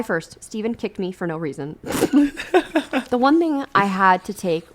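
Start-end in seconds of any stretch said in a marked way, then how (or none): 1.8-3.1 bursting into high laughter; sudden high-pitched laugh. Real, not acted. Laugh alone on line.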